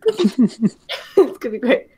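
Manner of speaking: slow and loud through laughter; laughing. Real, not acted. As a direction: say it while cracking up. Laughing while announcing taunt.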